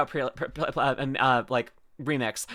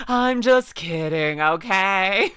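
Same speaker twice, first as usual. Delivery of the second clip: slow, stretched-out I'm just kidding; slowly spelled-out reassurance; drawn-out; much slower than usual. Acted. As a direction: drag every word out. Final, drawn-out disclaimer.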